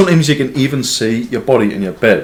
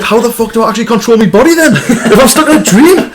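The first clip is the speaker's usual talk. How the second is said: much higher, half-laughing panic; mock terror, pitched way up; panicked quote; 12 semitones higher, 7 dB louder than usual. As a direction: squeal it out as if stuck in a dream, laughing. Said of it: "And you're like".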